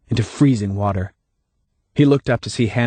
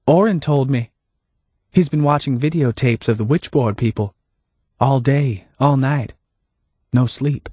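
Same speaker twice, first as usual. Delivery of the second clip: higher, lightly voiced Hannah; Hannah, calm and knowing; 4 semitones higher than usual.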